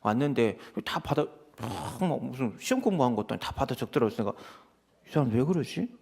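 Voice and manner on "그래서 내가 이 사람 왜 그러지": ordinary talk, nothing marked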